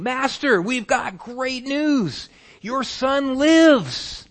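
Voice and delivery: high, loud, slowed joyful announcement; joyful shout. Acted. Servants shouting to their master that his son lives. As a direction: burst out with the good news.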